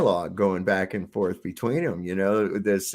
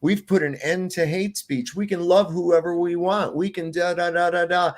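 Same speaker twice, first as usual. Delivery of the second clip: higher, singsong mock pride; mocking boast; 6 semitones higher than usual. Villain's victim boasting of freedom, higher mock pride. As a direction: parody their smug bragging.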